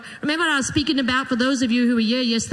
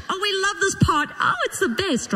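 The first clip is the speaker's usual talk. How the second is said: mock delight; 9 semitones higher than usual. Mock delight at marriage pressure.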